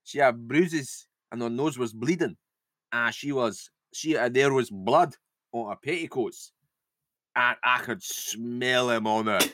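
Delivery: drifting Scottish-northern, with heavy pauses; vague northern accent. Acted. Lizzie reports bruises and blood, halting northern accent. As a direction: dwell on each detail in the faked northern accent. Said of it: "go for a vague northern accent".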